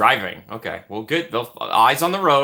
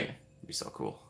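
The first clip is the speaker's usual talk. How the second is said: whispered aside; 14 semitones lower, 19 dB quieter than usual. Real, not acted.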